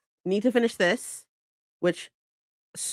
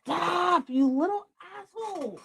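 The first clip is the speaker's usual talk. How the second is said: high, exasperated scold at the pet; scolding the cat; 8 semitones higher, 4 dB quieter than usual. Real, not acted. Streamer scolding her yelling cat, high and exasperated. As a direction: tell the cat off in a higher voice.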